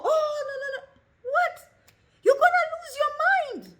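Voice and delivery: high, loud alarmed cry; panicked. Pretend. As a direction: shriek in mock panic. Panic over overload.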